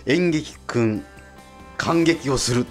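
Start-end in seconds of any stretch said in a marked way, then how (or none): none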